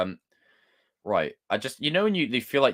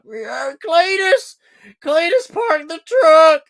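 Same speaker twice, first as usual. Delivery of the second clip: very high, loud, drawn-out American holler; American accent; 11 dB louder, much slower than usual. Shown as a demonstration. Streamer does American accents on request, high hollering caricature. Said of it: "do more American accents".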